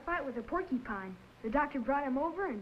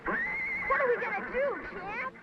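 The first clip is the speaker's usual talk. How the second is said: anxious; 10 semitones higher, slower than usual. Acted. Boy frightened over hurt dog.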